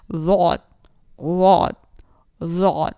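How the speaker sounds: واد said loud and heavy; full heavy daad. Shown as a demonstration. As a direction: boom the ض out with full air. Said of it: "فل باری آواز ... نکالنی ہے". (ضاد بہت بھری اونچی آواز میں)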